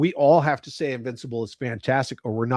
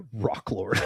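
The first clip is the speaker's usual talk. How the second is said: usual speaking voice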